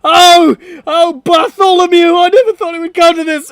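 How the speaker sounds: high, loud, fast melodrama; melodramatic anguish. Acted. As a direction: wail it like a soap-opera tragedy. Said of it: "he says".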